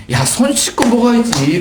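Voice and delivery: high, slow, drawn-out parental nag; singled-out scolding, stretched and high; nagging parent. Acted. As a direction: nag a child as an acted parent, pitched high. Parent nagging child to wash hands.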